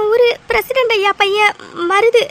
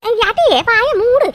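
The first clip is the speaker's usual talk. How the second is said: raised rebuke; sharp scolding; 4 semitones higher, 4 dB louder than usual. Acted. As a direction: shut her up firmly. Scolds younger woman to shut up.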